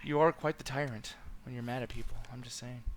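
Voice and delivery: ordinary talk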